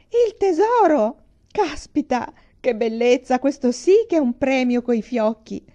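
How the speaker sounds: high, loud overjoyed cry; energetic outburst. Acted. Lady in the raincoat grabbing her prize, thrilled shout. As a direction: burst out with delight. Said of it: "prorompere in un energico".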